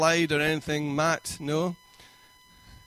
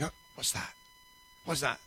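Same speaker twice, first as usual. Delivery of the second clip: hushed, nervous whisper at a noise; scared whisper; 10 dB quieter than usual. Pretend. Viewer startled by a noise at night, frightened whisper. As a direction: whisper it like something's in the house.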